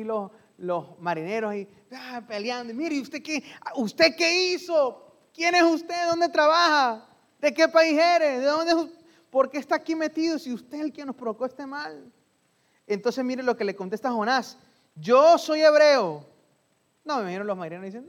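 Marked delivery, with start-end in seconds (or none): 2.8-12.1 interrogatorio airado, más agudo y fuerte; marineros furiosos. Acted. Marineros increpan a Jonás. 14.9-16.3 declaración lenta, fuerte y aguda; Jonás solemne. Acted.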